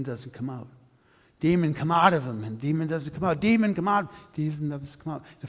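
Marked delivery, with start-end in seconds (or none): 1.4-2.4 commanding. Acted. Disciples commanding again, louder. 3.4-4.1 louder and higher, straining to command; the disciples straining to drive the demon out, rising shout; desperate commanding shout. Acted. Disciples failing to cast out the demon, desperate shout.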